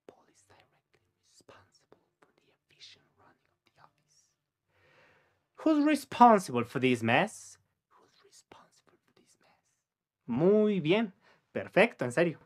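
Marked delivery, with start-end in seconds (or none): 0.1-4.2 faint whispered repeat of the sentence; voiceless, barely audible. Shown as a demonstration. Teacher whispering the sentence back for the learner. 7.9-9.6 faint whispered "Who's responsible for this mess?"; voiceless, barely audible repeat. Shown as a demonstration. Teacher whispering the question back for the learner. Model sentence then whispered echo.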